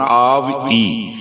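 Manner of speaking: ਆਵਈ drawn out, final ਈ stressed; ਆਵਈ with a strong ee. Shown as a demonstration. Teacher showing students the ending of ਆਵਈ, long strong ee. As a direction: lean hard on the ਈ in ਆਵਈ. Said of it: "ਈੜੀ ਦੀ ਬਿਹਾਰੀ ਈ ਕਰਕੇ ਬੋਲਣਾ, ਜ਼ੋਰ ਨਾਲ ਬੋਲਣਾ".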